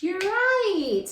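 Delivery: usual speaking voice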